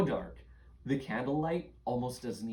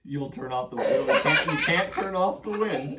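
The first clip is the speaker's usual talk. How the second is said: laughing; 3 semitones higher, 9 dB louder than usual. Real, not acted. Laughs at kid's idea.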